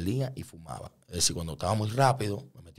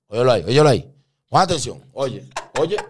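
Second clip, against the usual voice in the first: raised, urgent street call; sharp warning shouts, higher pitched; lookout calling out; 4 semitones higher, 8 dB louder than usual. Acted. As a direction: call out an alert to the crew.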